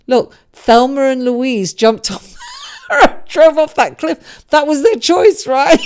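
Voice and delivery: loud, high, laughing burst; a thrilled, giggling outburst about the cliff jump; excited laughing shout. Real, not acted. Laughing excited Thelma and Louise.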